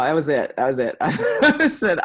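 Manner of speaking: loud laugh breaking through the words. Real, not acted. Poet telling the audience how she got hooked, laughing. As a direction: tell it while cracking up. Laughs while retelling her reaction.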